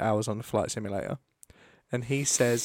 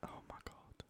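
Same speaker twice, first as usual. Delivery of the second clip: whispered; 28 dB quieter than usual. Real, not acted. Awed reaction.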